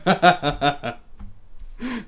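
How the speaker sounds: long run of giggling laughter. Real, not acted. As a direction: keep laughing in quick ha-has. Laughing at what he sees.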